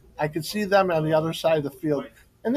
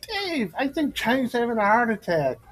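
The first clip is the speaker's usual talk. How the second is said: mock alarmed report; 9 semitones higher than usual. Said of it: "And Dietz goes".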